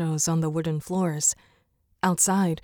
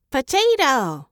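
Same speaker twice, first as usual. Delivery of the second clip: much higher and louder proud squawk; pleased parrot squawk; 7 semitones higher, 5 dB louder, slower than usual. A narrator's reading. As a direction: show off the word like a smug bird. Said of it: "much too pleased with himself".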